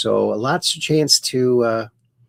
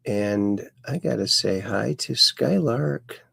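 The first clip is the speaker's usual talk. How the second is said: normal talking voice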